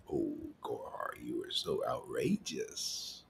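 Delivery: low, hushed whisper, drawn out at the end; mock-shocked whisper. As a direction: whisper it like a scandalized objector. Voicing a critic's shocked objection.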